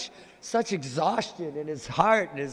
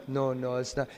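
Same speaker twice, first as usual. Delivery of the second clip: low, soft, shy no; a modest girl quietly holding her ground; shy Radharani turning the girls down; demure refusal; 6 semitones lower, 5 dB quieter than usual. Acted.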